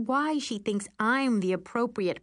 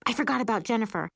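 plain reading voice

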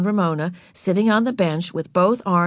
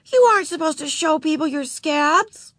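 scolding; 10 semitones higher than usual. A narrator's reading. Beezus scolding.

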